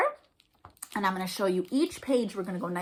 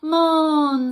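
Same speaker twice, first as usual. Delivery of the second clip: mummy moan; 5 semitones higher, 8 dB louder than usual. Acted. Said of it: "said the mother".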